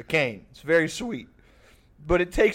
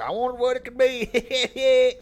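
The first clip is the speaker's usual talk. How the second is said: slow, higher mock-innocent old man; old shit kicker; 8 semitones higher, slower than usual. Great grandpa teasing the family, in a slow mock-innocent old man voice. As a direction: play a coy old cowboy pretending not to know. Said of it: "old fucking shit kicker style".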